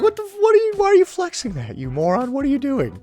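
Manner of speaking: high-pitched mock outrage; exasperated, high mock telling-off; incredulous scolding. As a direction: scold him in baffled disbelief.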